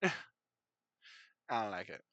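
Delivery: slow, laughing grumble; laughing complaint. Real, not acted. Narrator laughing at a border he dislikes, slow and amused. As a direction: chuckle through the complaint.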